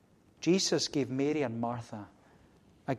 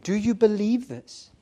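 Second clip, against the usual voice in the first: higher, stronger "Do you believe this?" with weight on "you"; pressing question; 6 semitones higher, 6 dB louder than usual. Acted. Question repeated, pressed harder.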